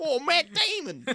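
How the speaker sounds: loud high hammed Boston yell; laughing Boston accent shout. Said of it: "Ben Affleck doing a Boston accent".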